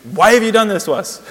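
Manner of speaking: loud, high, exasperated outburst; mad and confused. Acted. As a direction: demand it like an angry, bewildered mother. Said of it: "No, she's mad and confused".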